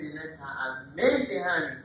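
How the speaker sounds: usual speaking voice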